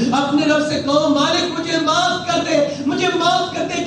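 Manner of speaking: impassioned pulpit appeal building to a plea; fiery preaching. Acted. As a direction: rouse the congregation to repent. Preacher exhorts the crowd.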